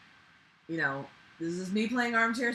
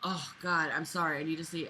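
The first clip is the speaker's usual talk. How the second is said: usual speaking voice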